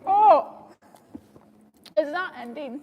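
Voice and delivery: talking around burning candy; words through a mouthful of candy; mouth full of sour candy. Real, not acted. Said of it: "Oh, it burns".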